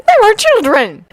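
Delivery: high, scandalised protest; high-pitched shocked reproach; horrified. A narrator's reading. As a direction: object in horror. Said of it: "Molly cried horrified".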